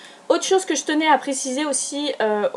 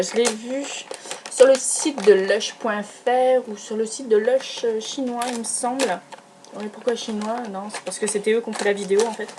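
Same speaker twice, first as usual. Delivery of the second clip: ordinary delivery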